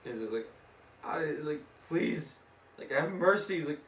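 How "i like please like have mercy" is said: raised, halting beg; mock pleading. Captain Ahab begs Deadpool for mercy, mock pleading. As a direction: plead with a higher voice, lightly acted. Acts Ahab begging for his life.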